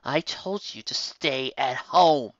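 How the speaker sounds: slow, measured, disappointed mother; slow stern mother. Acted. Mother scolding her son who disobeyed, slowly. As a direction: spell out each word slowly. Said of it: "she said slowly".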